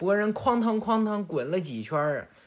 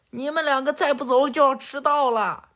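high, raised-voice parental call; shouting from the door; 7 semitones higher, 4 dB louder than usual. Acted. Father at the door to his squabbling kids, shouting. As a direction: holler to hurry the kids up. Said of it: "鸣人就在门口喊呀".

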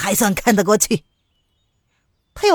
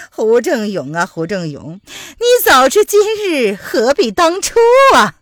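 changed voice; 3 semitones higher, 5 dB louder than usual. Acted. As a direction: drop the disguise mid-laugh. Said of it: "声音突然有异了". (声音突变，嘲笑)

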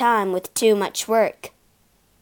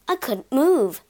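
usual speaking voice

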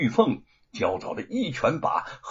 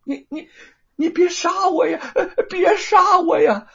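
terrified crying plea; 9 dB louder than usual. Acted. The thief begs not to be killed, sobbing in terror. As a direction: wail for mercy in panic. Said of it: "何莲害怕了".

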